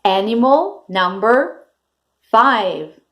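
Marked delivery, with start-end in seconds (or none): none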